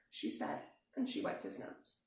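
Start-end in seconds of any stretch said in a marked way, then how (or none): none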